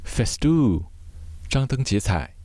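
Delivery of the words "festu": ordinary talk, nothing marked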